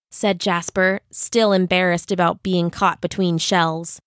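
ordinary delivery

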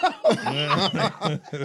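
laughs hard at his own joke; a loud, real laugh; loud burst of laughter. Real, not acted. Friend laughing at his own ugly-girl joke, loud laughter.

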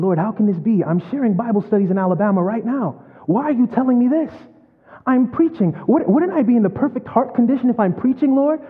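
a broken man begging the Lord why; anguished plea. Retold or imitated. Preacher pleads with God, crying.

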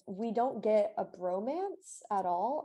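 normal talking voice

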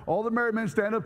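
ordinary talk, nothing marked

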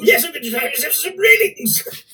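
high put-on cockney voice; cockney character voice. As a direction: sing it as a cheeky East Ender. Sung character line.

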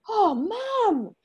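put-on high, loud voice of a startled child; imitating a child's wide-eyed alarm; shocked child. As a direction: gasp it like a concerned kid. Voices children seeing mum cry.

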